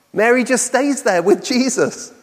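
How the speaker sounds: usual speaking voice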